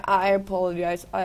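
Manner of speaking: usual speaking voice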